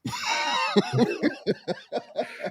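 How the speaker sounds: high-pitched burst of laughter; real laugh. Real, not acted. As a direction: crack up at your own story. Laughs after his own retold aside.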